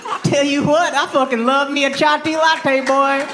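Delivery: high Texas twang; hammed-up cowboy, laughing. Cowboy voice continues.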